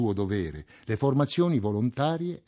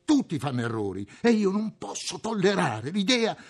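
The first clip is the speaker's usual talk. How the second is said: rising to a much higher, pleading outburst; vehement; 11 semitones higher than usual. A narrator's reading. Cottard protests to Tarou and Rieu, vehement and much higher. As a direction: protest hotly, voice climbing high. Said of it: "riprese con veemenza".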